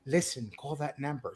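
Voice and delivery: hushed, laughing sultry line; soft sexy demo. Shown as a demonstration. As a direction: breathe it out softly, laughing. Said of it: "go like this, Angel".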